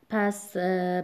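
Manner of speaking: usual speaking voice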